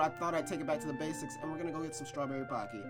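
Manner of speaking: ordinary delivery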